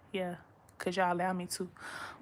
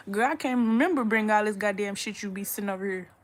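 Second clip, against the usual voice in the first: ordinary delivery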